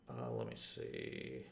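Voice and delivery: usual speaking voice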